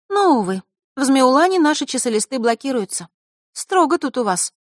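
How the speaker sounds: put-on high girl's voice; girl explaining. A narrator's reading. Diana explains why messages are blocked, high girl's voice. Diana's voice continues after tag.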